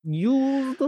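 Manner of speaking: demonstrated Russian accent; Russian accent with stretched, swooping words. Shown as a demonstration. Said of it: "how I do the Russian accent".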